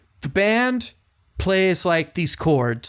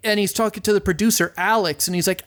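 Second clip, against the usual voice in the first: normal talking voice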